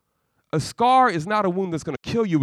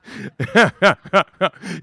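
warm chuckling laugh; real laughter; 4 dB louder than usual. Real, not acted. Preacher laughing at his babysitting joke, warm chuckle.

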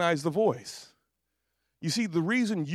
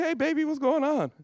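put-on higher-pitched, sweet greeting to a baby; baby talk; 8 semitones higher than usual. Babysitter greets the waking baby, cooing in a high voice. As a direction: coo hello to a baby.

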